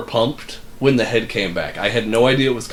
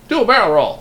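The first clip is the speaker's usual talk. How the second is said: higher, louder sidekick call-out; game sidekick voice; 7 semitones higher, 5 dB louder than usual. Acted. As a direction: shout it like a cartoon wingman. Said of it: "per the request of his flight companion".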